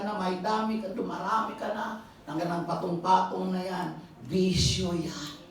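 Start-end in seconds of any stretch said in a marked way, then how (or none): 4.3-5.4 slow stress on bisyo; slow emphatic punchline. Acted. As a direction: drag out bisyo to land it. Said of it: "preaching punchline".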